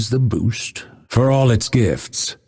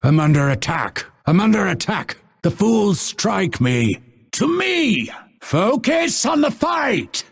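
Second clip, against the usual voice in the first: high-pitched battle cry, rising in urgency; combat alarm; 13 semitones higher than usual. Acted. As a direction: call out under attack, climbing ever higher. Combat alarm in game lines.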